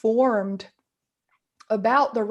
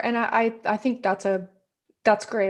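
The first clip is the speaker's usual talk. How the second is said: normal talking voice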